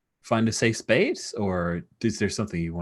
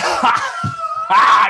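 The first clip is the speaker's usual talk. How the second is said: loud, high hearty laugh; big booming laugh; 9 dB louder than usual. Acted. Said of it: "And he laughs".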